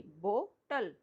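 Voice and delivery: Bottle in two separate beats, bo and tal; slow demo of bottle with a gap before tal; split into syllables. Shown as a demonstration. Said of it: "टू parts हैं".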